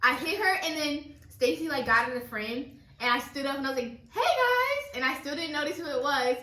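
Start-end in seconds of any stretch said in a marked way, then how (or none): 4.1-5.0 high, bright vlog hello; chirpy greeting. Retold or imitated. Girl imitating her own cheery camera greeting. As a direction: greet the camera sing-song.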